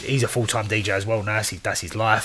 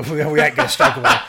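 laughing; 6 semitones higher, 7 dB louder than usual. Retold or imitated. Quoting mates, laughing.

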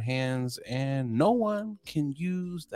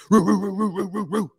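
high, loud barking roos; mock pit bull barks; imitated dog bark; 10 semitones higher, 8 dB louder than usual. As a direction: bark like a big dog charging.

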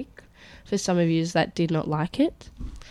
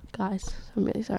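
whispering; 11 dB quieter, faster than usual. Acted. Joking ASMR-style apology.

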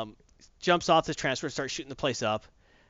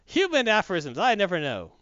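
droid voice; 5 semitones higher, 4 dB louder than usual. Retold or imitated. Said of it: "droid excuse".

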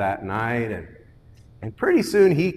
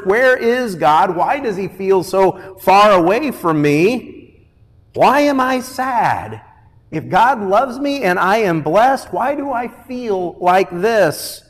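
higher, insistent plea; anguished questioning; 7 semitones higher, 5 dB louder than usual. Acted. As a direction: cry the questions up to heaven.

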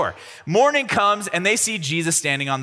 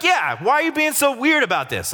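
high-pitched, exasperated mock disciples; playfully put-on indignation; acted incredulous reply; 9 semitones higher than usual. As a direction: push back at a weird stranger, voice pitched up. Disciples challenge the odd stranger.

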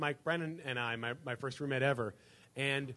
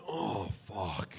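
low, drawn-out mock groan of misery; imitated hungover groan; 8 semitones lower, 4 dB quieter than usual. Friend's brother sitting down at breakfast, imitated hungover groan. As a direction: groan it low, like a wrecked morning after.

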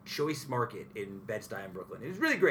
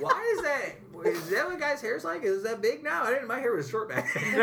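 put-on puzzled child; 10 semitones higher than usual. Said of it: "I remember growing up thinking, like".

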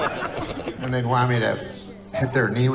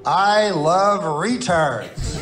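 slow deliberate quote; 3 semitones higher, 3 dB louder, much slower than usual. Shown as a demonstration. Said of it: "The context of me using the word".

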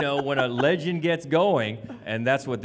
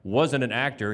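ordinary talk, nothing marked

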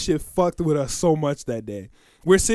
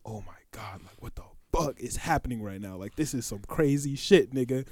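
hushed panic; 8 semitones lower, 11 dB quieter than usual. Retold or imitated. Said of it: "I'm like".

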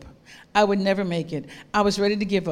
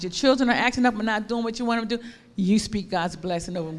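ordinary talk

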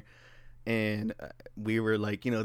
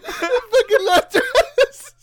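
high, slow words through laughter; laughing hard; 10 dB louder, much slower than usual. Real, not acted. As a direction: speak while cracking up.

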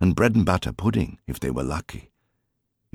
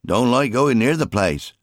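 higher, louder voice of a weary father; a higher, louder father half-joking about his wife's anger; rueful fisherman father; 7 semitones higher, 4 dB louder than usual. A narrator's reading. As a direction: grumble to your son while rowing hard. Jim voiced in story.

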